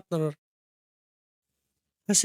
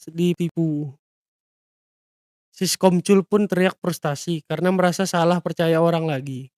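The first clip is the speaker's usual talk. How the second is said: ordinary delivery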